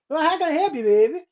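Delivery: put-on New Orleans accent. Imitating a helper down in New Orleans, New Orleans accent. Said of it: "you go down to New Orleans".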